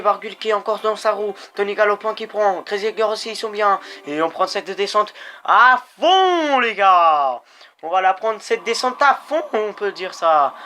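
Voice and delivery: fired-up race calling; excited commentary. Real, not acted. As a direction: call the descent like it's live. Thrilled race descent commentary.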